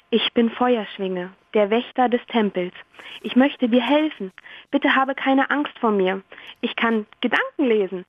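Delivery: ordinary talk, nothing marked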